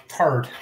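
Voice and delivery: third said as tird, no th sound; Irish th in third, like tird. Real, not acted. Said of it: "It's the Irish for third".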